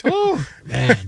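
hammed-up relief after the story; mock exasperated. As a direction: blow it out, exasperated. Mocking his own story.

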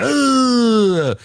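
mock exasperated groan; annoyed groan. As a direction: groan like you've been caught out. Host reacting to late anonymity request.

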